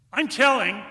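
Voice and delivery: put-on high, loud indignant kid yell; tattling child's cry. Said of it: "as a voice cries".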